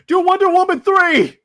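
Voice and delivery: high, loud, eager demand; excited studio exec. Retold or imitated. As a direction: shout it like a thrilled exec. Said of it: "like, going".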